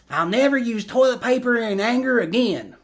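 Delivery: streamer's Bobby Hill attempt, high and fried; Bobby Hill impression. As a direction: do Bobby Hill's squeaky kid voice. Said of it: "That was, that was not too bad".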